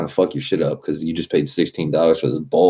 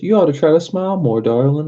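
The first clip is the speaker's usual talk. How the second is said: much higher, fast, put-on creepy customer voice; mock old man; 11 semitones higher, 4 dB louder, faster than usual. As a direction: ham up the patronizing stranger. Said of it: "and he goes".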